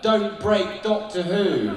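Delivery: high, loud, slow mock plea while laughing; mock yell. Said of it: "people would be like".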